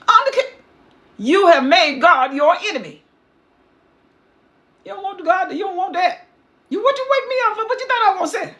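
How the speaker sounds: high, scornful voice; mocking Samuel. Samuel scorns Saul's claim to be king, high and mocking. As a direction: sneer at the king.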